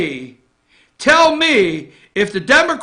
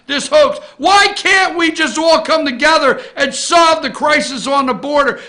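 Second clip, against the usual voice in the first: high, raised voice of indignant appeal; exasperated plea; 6 semitones higher than usual. Acted. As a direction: confront a politician with mounting exasperation. Acting out a plea to Jerry.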